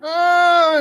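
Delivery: long, high, loud "aah"; loud retold wail of pain; mock pained cry. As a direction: imitate a burn patient crying out, drawn out. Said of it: "he starts being like".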